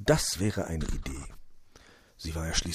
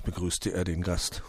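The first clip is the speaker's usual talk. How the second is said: usual speaking voice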